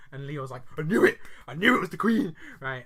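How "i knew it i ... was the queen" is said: much louder, higher retold outburst; mock triumphant shout. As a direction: crow it loudly as an excited little boy. Retelling child's excited outburst.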